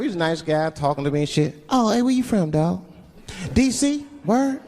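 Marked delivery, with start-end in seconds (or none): 1.7-4.6 higher-pitched chatty driver; limousine driver. Acted. Driver chatting with late-night passenger, higher casual voice. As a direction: make friendly small talk in a raised voice. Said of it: "I had a limousine driver".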